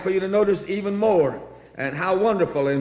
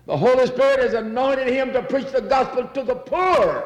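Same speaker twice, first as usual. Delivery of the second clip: preaching climax; 6 semitones higher than usual. Acted. Preacher rising to a peak.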